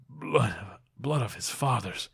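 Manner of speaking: very soft, fading words; faint murmur. Acted. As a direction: barely breathe the words out. Dying man murmuring.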